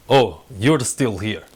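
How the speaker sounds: put-on slow, low menacing Sokovian accent. Spotting an unwelcome rival still here, menacing Sokovian accent. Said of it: "her Sokovia accent's back".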